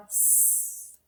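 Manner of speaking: a long, soft voiceless "S" hiss; letter sound. Shown as a demonstration. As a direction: hiss the "S" gently. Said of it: "do our sounds".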